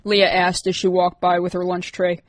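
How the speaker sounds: plain reading voice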